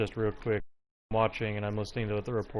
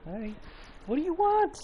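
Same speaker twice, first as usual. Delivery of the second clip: high pet voice. Real, not acted. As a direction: squeak at the pestering cat. Cat demanding attention.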